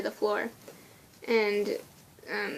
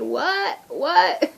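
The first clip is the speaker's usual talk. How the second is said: higher, puzzled questioning; louder, incredulous repeat; baffled dad; 6 semitones higher, 5 dB louder than usual. Retold or imitated. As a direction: imitate a dad who can't make it out. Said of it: "he had no idea what I was saying".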